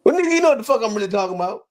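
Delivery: high, fast exasperated retort; defensive, rushed comeback. Real, not acted. Defends himself after being corrected.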